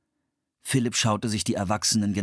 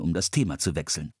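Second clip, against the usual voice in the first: plain reading voice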